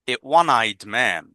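"one" said as "wan", slowly, after a plain a; one with w sound. Shown as a demonstration. Teacher showing why one takes a. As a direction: stretch "one" to show the w. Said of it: "ওয়া উচ্চারণ হয়".

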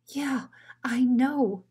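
hushed, breathless whisper; frightened little dog whispering. Acted. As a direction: whisper so the big dog can't hear. Drover, don't say a word.

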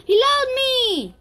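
high, loud delighted squeal; gasp, then squeal with joy; thrilled. Real, not acted. Thrilled the animal loves them.

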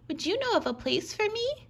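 high, little crab voice; small hermit crab. A narrator's reading. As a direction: ask like a shy little crab.

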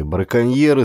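plain reading voice

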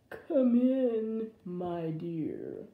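put-on wolf posing as scratchy old granny; scratchy fake granny voice. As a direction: draw it out, scratchy, falling away. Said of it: "with a scratchy voice".

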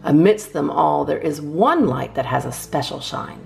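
plain reading voice

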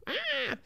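short, high-pitched "ah"; high squeal. Retold or imitated. Reacting to partner wanting to learn, high squeal.

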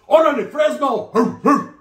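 high mock cheer with woofs; mock hollering. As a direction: holler it slowly to rib a friend. Friend's mocking call about puppy love.

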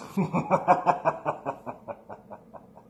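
high breathy sinister cackle; breathy evil cackle. Acted. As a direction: cackle like a ghoul. Villain cackle after beware.

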